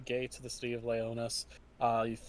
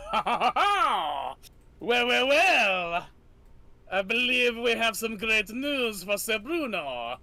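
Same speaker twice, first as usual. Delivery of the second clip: put-on villain Lefebvre gloating in French accent; 7 semitones higher, 4 dB louder than usual. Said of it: "waiting for this accent".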